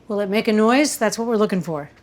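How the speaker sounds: ordinary talk, nothing marked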